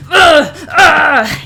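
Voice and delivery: high, loud grunt then a yelp of strain; hammed-up grunt and yell. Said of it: "playing it up".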